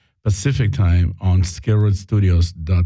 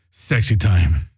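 sassy; 3 semitones lower, slower than usual. Shown as a demonstration. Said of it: "now say it with some sass".

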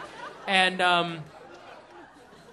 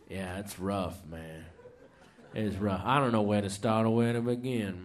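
Doctor Pepper's opener; 13 semitones lower than usual. Retold or imitated. Comedian opens the friend's set, low and worn out. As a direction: sigh it out low like a beaten man. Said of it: "Doctor Pepper's set verbatim".

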